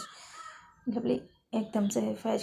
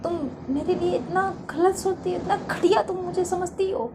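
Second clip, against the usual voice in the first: scornful anger; 4 semitones higher than usual. Acted. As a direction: throw the sorry back at her. Faiz scorning the apology.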